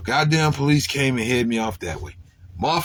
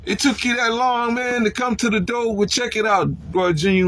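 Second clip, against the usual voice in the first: raised retold call at the doorway; mock annoyed call; 11 semitones higher than usual. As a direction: needle him for taking so long. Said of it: "So then I said".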